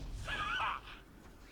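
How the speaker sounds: laugh. Acted. Enemy voice laughing.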